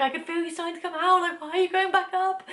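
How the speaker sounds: high, loud exasperated whine. Retold or imitated. Mum complaining to the baby slipping back up, exasperated high cry. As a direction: protest in frustrated disbelief, pitch climbing. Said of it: "the most frustrating thing in the world".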